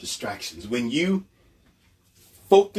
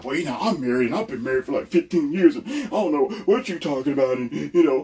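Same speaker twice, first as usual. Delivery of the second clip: high, fast, far whiny mock voice; mocking married men; 8 semitones higher, faster than usual. Imagined married listeners answering back, whiny mock voice. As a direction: whine it defensively like a touchy husband. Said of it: "I can hear y'all now".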